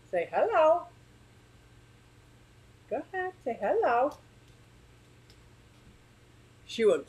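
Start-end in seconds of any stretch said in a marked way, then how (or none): 0.1-4.2 high, sing-song coaxing voice; coaxing pet talk. Real, not acted. Owner coaxes her parrot Sophie to say hello. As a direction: sweet-talk the bird into speaking.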